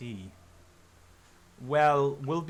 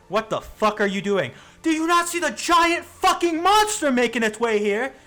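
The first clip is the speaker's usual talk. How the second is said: panicked yell; 11 semitones higher, 10 dB louder than usual. Acted. Panicked cultist yelling about monster.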